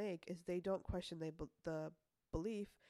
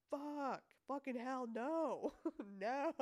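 high whiny protest breaking into laughter; panicking ego; 6 semitones higher, 3 dB louder, much slower than usual. Pretend. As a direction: play the ego refusing, laughing. Ego voiced, refusing change.

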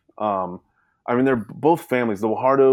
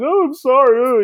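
high, louder mock-sheepish whine; husband caught crying, mock-apologizing to wife; hammed-up apology; 7 dB louder than usual. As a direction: ham up a guilty, teary apology. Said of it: "I'm like".

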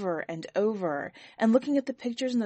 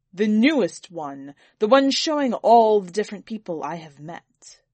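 forceful answer, louder throughout; loud, impatient order; 2 semitones lower, 8 dB louder than usual. A narrator's reading. Master telling servant which album, loud and commanding. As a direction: spell it out loudly for the servant.

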